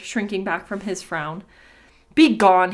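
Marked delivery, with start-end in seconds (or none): 2.2-2.7 loud, curt command; firm, acted angry send-off; angry dismissal. A narrator's reading. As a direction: order them away angrily. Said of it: "he bid her angrily".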